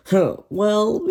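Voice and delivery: high loud sarcastic huh. Real, not acted. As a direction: scoff before the punchline. Sarcastic setup before punchline.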